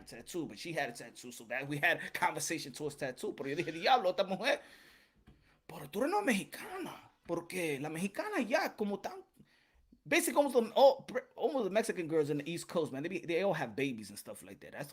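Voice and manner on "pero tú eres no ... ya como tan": whispered aside. Retold or imitated. Confiding aside about the woman.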